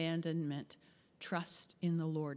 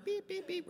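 high, soft beeping; imitated warning beeps; 13 semitones higher, 5 dB quieter than usual. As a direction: mimic a car's oil warning beep. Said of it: "when your oil light goes on".

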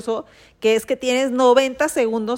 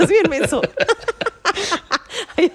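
teasing through laughter; laughing tease; 7 semitones higher, faster than usual. Real, not acted.

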